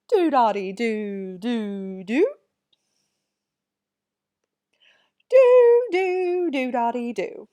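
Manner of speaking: hammed-up English accent, loud with swooping pitch; exaggerated English accent, loud and theatrical. Shown as a demonstration. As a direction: chant it in a silly mock-English accent. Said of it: "say it with our best English accents".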